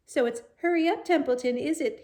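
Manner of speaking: high, loud mocking whine; mocking imitation. A narrator's reading. Templeton imitating Wilbur, loud mocking high whine. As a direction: parrot the pig sarcastically. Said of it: "imitating Wilbur's voice".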